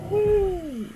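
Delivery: long, very high "woo"; high squealed whoop; excited whoop. Real, not acted. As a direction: let out a high-pitched whoop. Whooping as the game moves.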